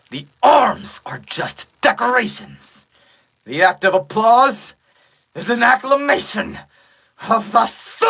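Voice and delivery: high, loud villain roar; booming villain climax; villain's shouted proclamation. Acted. Villain's speech building to a shout.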